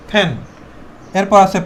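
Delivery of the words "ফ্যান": ফ্যান begun with a puffed P; aspirated P. Shown as a demonstration. Teacher answers what we will say, aspirated P. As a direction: let air burst out after the P in ফ্যান. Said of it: "কি বলবো?".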